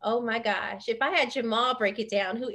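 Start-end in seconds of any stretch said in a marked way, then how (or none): none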